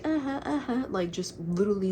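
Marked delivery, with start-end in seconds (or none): 0.0-0.9 high mocking uh-huhs; trolling. Kids brushing off a stranger's threat, trolling. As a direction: egg him on with raised-pitch mock agreement. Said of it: "trolling him".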